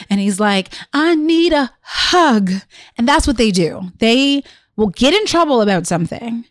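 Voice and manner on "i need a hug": put-on higher, drawn-out child's plea; mock a crying child slowly begging for comfort; imitated tearful plea. Mother imitating crying son back downstairs, slow tearful plea. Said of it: "and he's just crying".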